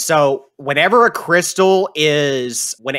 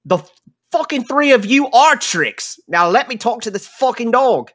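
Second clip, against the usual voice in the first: high, loud angry camp boss yelling back; gruff NPC shouting; 9 semitones higher, 4 dB louder than usual. Acted. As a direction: bark it out like an irate old tinkerer. GM voicing NPC.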